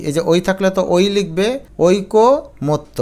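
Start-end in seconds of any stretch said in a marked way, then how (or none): 1.9-3.0 ঐকমত্য very slow, "oi-ko... mot-to"; syllable by syllable. Shown as a demonstration. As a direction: draw out and split ঐকমত্য. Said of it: "এটার উচ্চারণ". (ঐকমত্য, খুব ধীরে ভেঙে)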